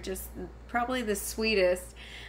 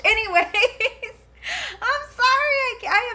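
high, loud, laughing over the words; laughing apology; 13 semitones higher, 7 dB louder than usual. Real, not acted. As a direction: apologise while laughing helplessly. Laughs about her daughter's crash.

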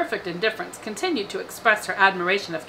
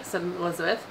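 ordinary delivery, nothing marked

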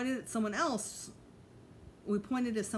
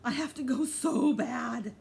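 urgent; 5 semitones higher, 6 dB louder, slower than usual. A narrator's reading. Urgent girl running to house.

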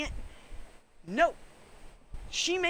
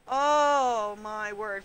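drawn-out exasperation; 4 semitones lower, much slower than usual. Real, not acted.

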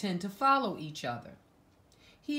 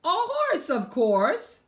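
much higher, louder triumphant "horse"; excited reveal; 10 semitones higher, 8 dB louder, much slower than usual. Acted. Reading the twist ending to a child, high and loud. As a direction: announce it like the big surprise.

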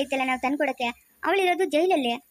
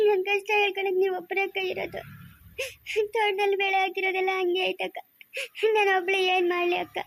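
acted sobbing lament with long tearful pauses; crying; 4 semitones higher than usual. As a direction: wail out your grief between sobs. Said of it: "ಅಳ್ಬಾರ್ದು ನೀನು".

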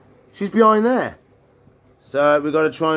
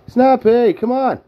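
loud, higher name call; 5 semitones higher, 5 dB louder, slower than usual. Real, not acted. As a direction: call her loudly to come. Calling turtle to food.

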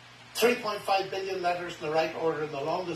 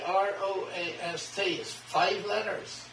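normal talking voice

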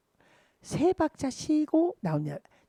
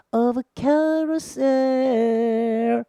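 "carousel" sung slow and rising, louder; accent on carousel; 5 dB louder, much slower than usual. Shown as a demonstration. Teacher showing students where to accent carousel. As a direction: punch "carousel" with a sharp accent. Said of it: "액센트를 팍 하세요".

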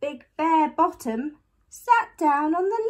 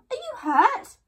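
high, louder anxious question; worried call; 13 semitones higher, 5 dB louder than usual. A narrator's reading. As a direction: call it out with raised pitch. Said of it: "called Mum".

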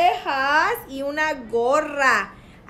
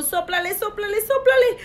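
higher, quick excited repetition; excited urging; 6 semitones higher, much faster than usual. Pretend. Teacher urging a child to blow out candles, excited. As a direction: urge them on in a quick, high voice.

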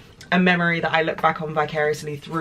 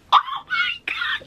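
very high, drawn-out breathless squeal; overjoyed high squeal; thrilled squeal; slower than usual. Real, not acted. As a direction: squeak it out in disbelief, barely voiced. Thrilled by brand's note.

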